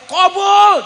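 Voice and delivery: high loud call; comic loud answer; punchline call. Real, not acted. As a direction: bang out the answer. Punchline granted.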